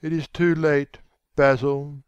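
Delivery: despairing, mildly faltering voice. A narrator's reading. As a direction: falter it out to your friend. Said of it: "he faltered".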